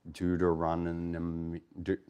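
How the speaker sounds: "Deuteronomy" drawn out slowly and softly, then breaking off; slow, fumbled attempt at saying "Deuteronomy"; slow, stumbling. Real, not acted. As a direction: stumble through "Deuteronomy" syllable by syllable, losing it. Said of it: "if I say it slow, I kinda mess it up".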